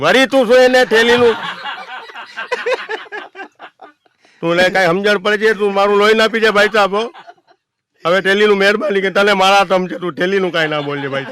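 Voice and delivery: very high, loud fed-up yelling; furious exasperation. Retold or imitated. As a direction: beg him at full volume to drop it. Another passenger fed up.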